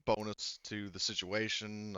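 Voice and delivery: usual speaking voice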